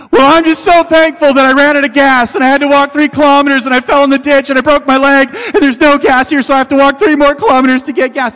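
high, loud overwrought fake thankfulness, speeding at the end; mock grateful rant. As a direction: pile it on absurdly. Third interview, escalating absurdity.

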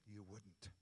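very soft, low voice, barely audible; hushed and low, just enough to hear yourself; quiet retort. Retold or imitated. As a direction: say it under your breath in church. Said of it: "with my voice that I could hear".

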